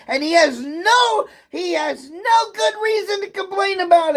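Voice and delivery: high, strained angry shouting near tears; furious tearful rant. Real, not acted. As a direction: shout the injustice, voice cracking. Furious rant about being bullied.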